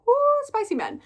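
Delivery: giddy high woo; gleeful cheer. Real, not acted. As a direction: cheer like a fan.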